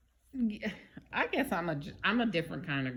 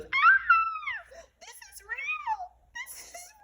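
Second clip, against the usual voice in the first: very high, crying out in shock; terrified cry; 4 dB louder than usual. Retold or imitated. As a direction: shriek it out through tears as if the nightmare is real. Wife wakes from terrifying vision.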